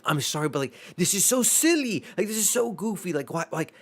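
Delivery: high-pitched, exasperated retold plea; amused protest. Moviegoer defending their laughter to a mad coworker, high amused protest. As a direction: re-enact a pleading protest, pitch soaring.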